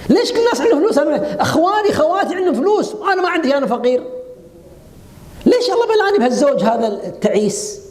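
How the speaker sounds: high, quick, aggrieved whine at fate; complaining woman. Retold or imitated. Preacher imitates a woman protesting against God's decree, high and whiny. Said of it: "احتجاج على قدر الله".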